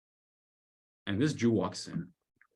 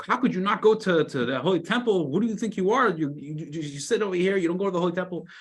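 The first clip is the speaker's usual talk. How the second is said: high, louder reproach; indignant scolding; 8 semitones higher, 3 dB louder than usual. Retold or imitated. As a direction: scold him for skipping the temple. Townspeople upset at the man.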